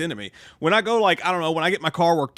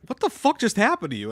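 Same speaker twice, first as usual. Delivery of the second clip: baffled; 7 semitones higher than usual. Retold or imitated. Retold baffled reaction to accent.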